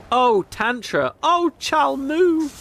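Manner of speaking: high, slow, hammed-up cries of passion; mock passionate moaning. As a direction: ham up the lovers' ecstatic cries, drawn out. Lovers' climax, mock moaning.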